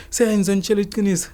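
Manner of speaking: fast, high mock begging; mimicked scared pleading. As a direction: mimic his terrified confession. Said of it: "He was like this".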